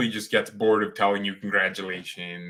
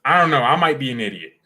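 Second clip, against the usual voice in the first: loud outburst; 4 semitones higher, 7 dB louder, faster than usual. Real, not acted. Sudden loud outburst while watching.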